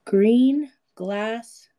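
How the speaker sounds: very slow, puzzling it out; slow repeat. Real, not acted. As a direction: mull the words over slowly. Repeating the riddle phrase.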